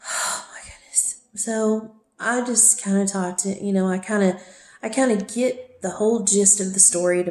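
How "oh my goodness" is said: sighed, whispered oh my goodness; exasperated whisper. Real, not acted. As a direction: breathe it out in a tired whisper. Sighing over his behaviour.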